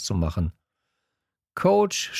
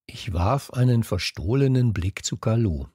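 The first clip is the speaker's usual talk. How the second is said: ordinary delivery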